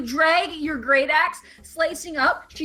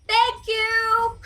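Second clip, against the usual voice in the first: mock cheer; 11 semitones higher, 3 dB louder than usual.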